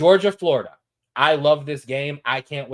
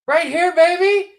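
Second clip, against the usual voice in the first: hyped-up squeaky voice; 9 dB louder than usual. Pretend. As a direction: squeak it out like a cartoon hype man. Hypes his bets on screen.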